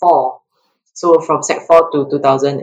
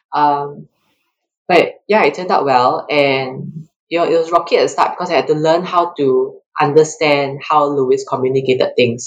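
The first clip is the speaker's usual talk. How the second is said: usual speaking voice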